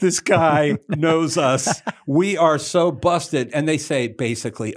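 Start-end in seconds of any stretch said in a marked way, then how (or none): 0.0-3.5 caught out. Retold or imitated. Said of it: "They were like".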